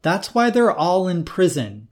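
a hint of fed-up scolding; exasperated daughter. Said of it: "she said, exasperated".

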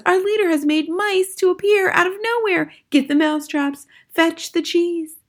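very high excited crowd voice; shouted. A narrator's reading. Witch audience hailing their leader's trick, high shouting. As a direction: call out in a high squeal. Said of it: "shouted the audience".

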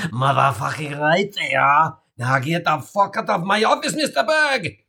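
lightly voiced sinister principal building to a yell; menacing principal. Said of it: "Svet smiled menacingly ... and said".

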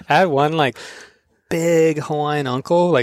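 ordinary delivery

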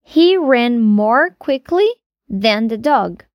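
slow, deliberate correct sentence; slow correct example. Shown as a demonstration. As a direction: model the right form slowly. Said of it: "a gente diz". (frase correta dita devagar)

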